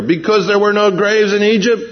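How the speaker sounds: imitating the Israelites' complaint; crying out to Moses. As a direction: whine at Moses like a scared crowd. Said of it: "what they're crying out here".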